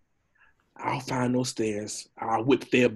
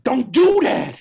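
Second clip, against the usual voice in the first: high, loud frightened yell; panicked shout; 12 semitones higher, 8 dB louder than usual. Acted. Donkey panics on swaying bridge.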